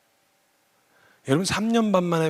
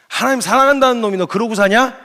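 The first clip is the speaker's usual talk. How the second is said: mock rebuke; 7 semitones higher, 8 dB louder than usual. Imagined accuser's rebuke.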